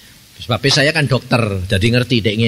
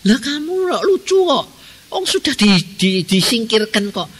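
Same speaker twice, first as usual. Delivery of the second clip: high, scolding pastor voice; mock scolding; 9 semitones higher than usual. Pastor scolding a fallen preacher, high and incredulous. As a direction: scold him, pitched high.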